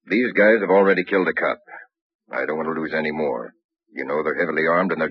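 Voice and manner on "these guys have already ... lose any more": ordinary talk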